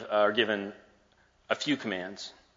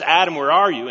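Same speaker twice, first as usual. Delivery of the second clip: louder call, searching for someone hiding; 6 semitones higher, 11 dB louder than usual. Acted. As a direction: call out across the garden. God calling out for Adam.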